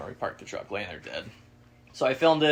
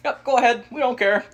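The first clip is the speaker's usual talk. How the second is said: high, louder breezy yes; old lady's voice; 11 semitones higher, 7 dB louder than usual. Retold or imitated. Landowner lady giving hunting permission, high and breezy. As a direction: grant it without a second thought. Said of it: "She's like".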